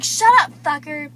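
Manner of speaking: loud rude brush-off; snapping shout. Retold or imitated. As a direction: bark it at him. Desiree snapping at Zach retold.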